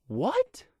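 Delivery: very high, loud, rising disbelief; stunned what. Acted. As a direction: gasp it out in shock. Stunned by walking through walls.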